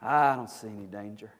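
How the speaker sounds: low, soft, yawning voice; drowsy, unconcerned; yawning reply. Acted. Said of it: "kinda yawns and says".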